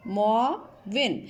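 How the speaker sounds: stretched out slowly, every sound clear; slow careful model. Shown as a demonstration. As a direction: say it slowly for the class. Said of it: "next لفظ ہے معاون".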